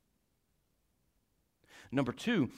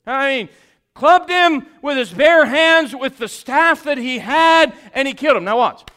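loud, high, driving sermon voice; full-force preaching; 9 semitones higher, 16 dB louder than usual. Acted. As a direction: hammer each line at the congregation.

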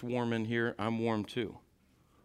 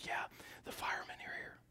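put-on child's whisper; 15 dB quieter, faster than usual.

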